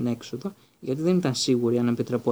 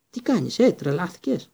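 a sudden shout, much higher and louder; 5 semitones higher, 4 dB louder than usual. A narrator's reading. Hagrid suddenly noticing Harry there, a high, loud shout. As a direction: yell it at Harry in alarm. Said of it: "φώναξε".

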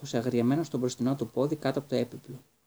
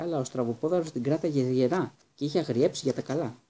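plain reading voice

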